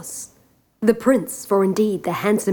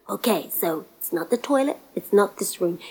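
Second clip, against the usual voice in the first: ordinary delivery, nothing marked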